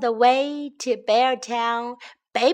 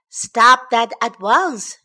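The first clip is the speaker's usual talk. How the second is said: loud, slow firm command; stern cry; 8 dB louder, slower than usual. A narrator's reading. Father bear halting cub in the doors, loud command. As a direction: order the cub to stop, with force. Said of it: "cried Father Bear".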